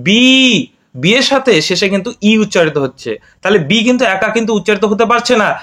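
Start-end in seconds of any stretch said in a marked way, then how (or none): 0.1-0.2 বি said as a clear, drawn-out "bee" for the class to copy; teacher modelling বি as "bee"; letter name demo. Shown as a demonstration. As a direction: model বি slowly so children repeat it. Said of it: "একসঙ্গে উচ্চারণ করো".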